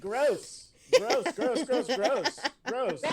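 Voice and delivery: high, laughing gross; laughing disgust. Real, not acted. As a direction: giggle out gross.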